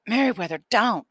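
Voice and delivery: a high, loud desperate cry; boy hollers to stop Meriwether striking; hollered. A narrator's reading. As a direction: yell it out to stop the blow. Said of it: "I hollered".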